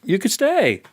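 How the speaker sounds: high-pitched imitation of a teacher giving in; retold teacher relenting. Teacher lets the student stay, high-pitched retold verdict.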